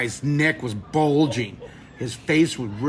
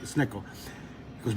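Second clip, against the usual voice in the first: ordinary talk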